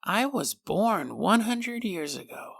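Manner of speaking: put-on slow, soft, eerie voice of an ancient thing in a baby; creepy baby voice. Changeling baby speaks to its startled mother, slow, soft and eerie. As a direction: let the changeling speak, slow and hushed, like something very old. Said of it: "she hears her little baby go".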